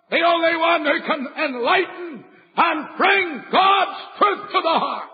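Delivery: pulpit-pounding revival preaching; preaching at full force. Acted. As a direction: thunder it at the young sinner. Climactic gospel appeal.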